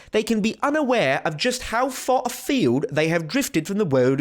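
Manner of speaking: put-on British quote-reading voice; British accent. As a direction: read it out in an amateur British accent. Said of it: "I can't do it in a British accent".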